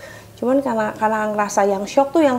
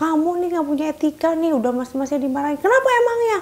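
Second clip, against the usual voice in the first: higher, rising scold; angry scolding; 5 semitones higher than usual. Retold or imitated. Husband scolding his wife, angry. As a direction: lecture her angrily.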